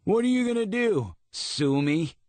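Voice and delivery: high, mocking bully voice; bully taunt. A narrator's reading. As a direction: sneer it out like a bully.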